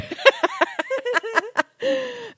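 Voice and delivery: high burst of laughter; big giggle at her own joke. Real, not acted. Host breaks into laughter at her own slip.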